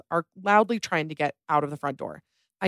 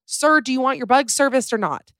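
higher, louder exasperated demand; exasperated yell; 7 semitones higher, 4 dB louder than usual. Retold or imitated. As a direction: snap at him, fed up. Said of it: "Exasperated, I yell".

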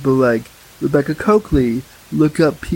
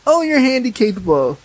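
mock patronizing, pitched up; higher, mockingly sweet condescension; infantilizing; 6 semitones higher than usual. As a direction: imitate someone talking down to a child. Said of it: "it just is so infantilizing".

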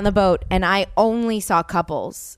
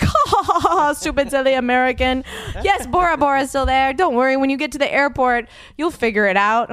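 put-on laughing and higher, a light Polynesian French accent; mocking laughing accent; 5 semitones higher than usual. Airline staff mocking the American tourist in a lightly put-on local accent. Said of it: "they have an accent".

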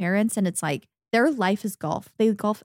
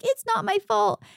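1 imitating crying boy; 12 semitones higher than usual. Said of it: "he's literally crying".

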